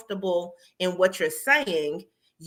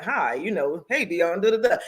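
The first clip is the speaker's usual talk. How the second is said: a hint of a stiff, put-on interviewer greeting; lightly mock the polished on-camera voice; fake interviewer tone; 2 semitones lower than usual. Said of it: "the inflection that you're putting on is like".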